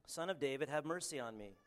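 normal talking voice

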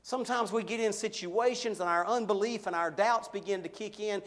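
usual speaking voice

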